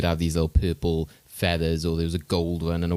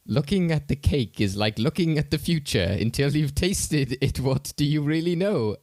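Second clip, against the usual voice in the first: quoting a film line; 8 semitones higher than usual. Retold or imitated. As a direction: deliver it like a portentous film character, pitched up. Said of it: "one of my favorite lines was".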